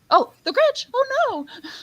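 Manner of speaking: very high-pitched mock panic; mock dread. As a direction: squeal it in pretend alarm. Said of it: "and he goes".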